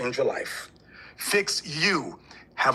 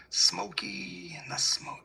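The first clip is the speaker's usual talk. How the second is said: slow whisper to himself; whispered to himself, forgetting a singer's name; whispered aside; 7 dB quieter, much slower than usual. Real, not acted. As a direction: mutter it under your breath while searching for the name. Muttering while trying to remember.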